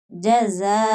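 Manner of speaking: जज़ाअन with the "zaa" held long; long madd. Shown as a demonstration. Teacher spells out the word, long madd in जज़ाअन. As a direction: stretch the middle vowel of जज़ाअन evenly. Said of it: "पाँच अलिफ की मिकदार के बराबर मद होगी".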